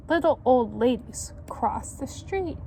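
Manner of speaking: put-on lively read-aloud to children; story narration. A narrator's reading. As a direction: tell it like a picture book. Reads picture book to children.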